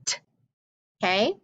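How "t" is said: "t" as a bare tongue tap; T letter sound. Shown as a demonstration. Teacher showing sound cards, voiceless "t" sound. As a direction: release "t" without saying its name. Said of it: "remember the sound".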